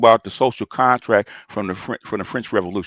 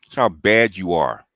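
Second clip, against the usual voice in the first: ordinary delivery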